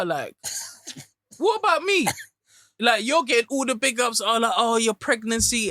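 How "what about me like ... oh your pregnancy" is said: high, loud complaining "what about me"; aggrieved new father voice. Retold or imitated. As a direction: voice a frustrated dad's outburst. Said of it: "And he's like".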